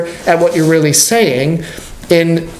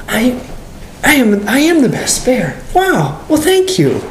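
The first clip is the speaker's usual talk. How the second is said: high-pitched dawning delight; brightening up; 9 semitones higher than usual. Acted. Believer who has gotten a word of grace, brightening up. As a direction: let the good news sink in, voice lifting. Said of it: "And then you brighten up".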